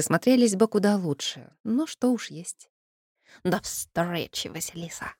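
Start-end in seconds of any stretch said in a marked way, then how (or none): 3.4-5.1 slow, soft creature farewell; merman voice. Acted. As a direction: draw out the farewell softly. Merman says goodbye.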